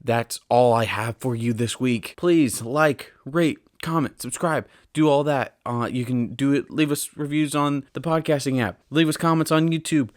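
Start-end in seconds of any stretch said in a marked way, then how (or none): 2.2-10.1 frantic. Real, not acted. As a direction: rattle off the plugs in a sudden burst of energy. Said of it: "I don't know why I'm so frantic all of a sudden".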